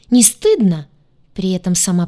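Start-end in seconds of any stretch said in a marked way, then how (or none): 0.1-0.9 высокий, громкий возмущённый вопрос; спроси с писком, стыдя собеседника. Acted. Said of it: "пискнула она". (пискливый упрёк)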